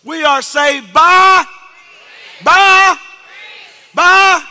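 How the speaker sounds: loud high call, drawn out; preaching shout. Acted. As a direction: shout it to the church, slowly. Preacher calling out.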